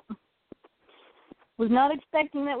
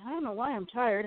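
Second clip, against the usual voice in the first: ordinary delivery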